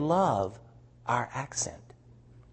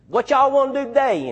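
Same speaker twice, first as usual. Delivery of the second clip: louder, higher down-home Southern accent; Southern drawl; 9 semitones higher, 5 dB louder than usual. Shown as a demonstration. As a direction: drop right into a folksy Southern drawl. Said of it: "I'll drop right into the talking like this".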